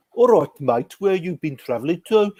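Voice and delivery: put-on impersonated Brummie accent. Railwayman asks a traveller where he's been, mock Brummie accent. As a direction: put on a Brummie accent for a chatty stranger's question. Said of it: "the accent was so out of context".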